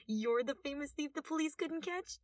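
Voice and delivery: amused doubting question; mocking young detective. A narrator's reading. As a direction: laugh at the famous thief.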